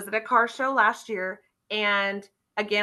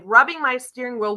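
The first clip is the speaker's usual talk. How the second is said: ordinary talk, nothing marked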